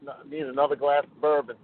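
ordinary talk

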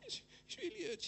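high, soft, hammed-up whine; mock whimper. Preacher acts his own plea to the doctor, high whiny whimper. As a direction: whimper it like a hurt child. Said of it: "I go".

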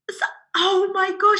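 high-pitched imitation of surprise; retold fluster, pitched right up; flustered customer. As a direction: act out a lady caught out. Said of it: "she was like".

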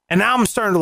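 ordinary talk, nothing marked

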